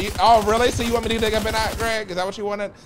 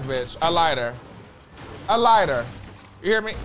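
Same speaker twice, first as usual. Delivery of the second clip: ordinary talk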